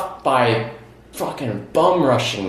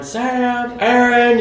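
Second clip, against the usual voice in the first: high, stretched names called down a street; imitated calling out; 10 semitones higher, much slower than usual. Searching the street for missing friends, imitated calling out. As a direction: call for lost friends, mimicked.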